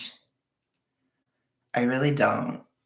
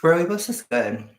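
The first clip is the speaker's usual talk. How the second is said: ordinary delivery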